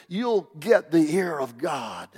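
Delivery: ordinary talk, nothing marked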